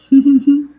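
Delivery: short burst of laughter. Real, not acted. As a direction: laugh it off after the singing. Laughing after singing.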